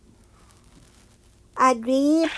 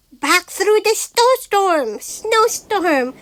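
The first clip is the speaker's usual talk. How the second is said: hurried flight; 5 semitones higher than usual. A narrator's reading. Fleeing bear.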